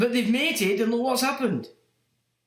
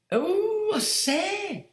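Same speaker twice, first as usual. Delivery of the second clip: mock toff exclamation; 8 semitones higher, much slower than usual.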